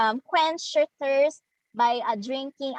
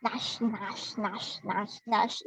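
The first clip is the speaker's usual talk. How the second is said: soft, repeated chomping "gnash"; quiet rhythmic munching noise; imitated gnashing sound; 3 semitones lower, 7 dB quieter, much slower than usual. Shown as a demonstration. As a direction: chant gnash softly, like teeth biting away. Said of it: "So it will be".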